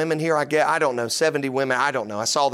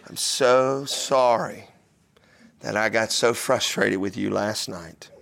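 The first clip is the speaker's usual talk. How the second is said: sincere apology; 6 semitones lower, slower than usual. Retold or imitated. Father apologizes to son.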